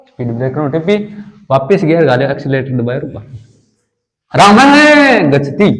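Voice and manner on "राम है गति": है punched and stretched long after Ram, then गति; forceful stress on है. Shown as a demonstration. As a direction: lean hard on a drawn-out है. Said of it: "यहाँ पे बलाघात हो रहा है". (है पर ज़ोर का बलाघात, खींचकर)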